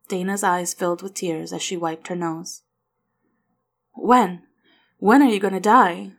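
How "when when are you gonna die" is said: loud, anguished question; pained, raised-voice demand; crying out. A narrator's reading. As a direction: cry it out like a distraught child. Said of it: "Will cried".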